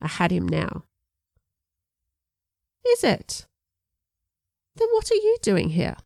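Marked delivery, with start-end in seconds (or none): 2.9-6.0 much higher, mock-surprised English accent; an English man playfully countering the girl's question. A narrator's reading. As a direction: turn the question back on her, English gent. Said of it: "strong English accent".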